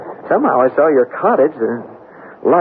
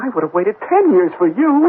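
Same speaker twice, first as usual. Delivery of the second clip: higher, pleading; hurt protest; 7 semitones higher than usual. Acted. Charlie to Hannah who didn't wait, hurt pleading. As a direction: pour out the hurt of waiting.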